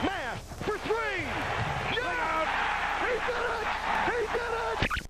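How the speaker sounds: high, rising triumphant shout; ecstatic call. Real, not acted. Commentator calling the game-winning three, ecstatic shout. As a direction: explode as the shot drops.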